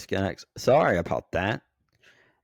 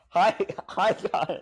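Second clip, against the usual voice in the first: high imitating greeting; 11 semitones higher than usual. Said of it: "it's just like".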